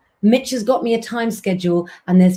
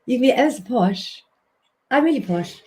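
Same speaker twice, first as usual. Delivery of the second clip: lips pushed up, put-on posh English voice; 3 semitones higher than usual. Shown as a demonstration. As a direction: play it ever so posh, lips lifted. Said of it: "if you try and talk like this".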